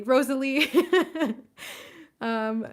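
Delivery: loud, high laugh over a name; giggling while calling out Rosalie; laughing burst. Real, not acted. Rushing through the name list.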